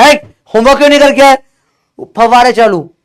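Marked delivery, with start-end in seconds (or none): 0.0-1.4 loud, high yell at a student; mock teacher scolding. Teacher scolding a student for missing homework, mock yell. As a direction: bark it like a strict teacher.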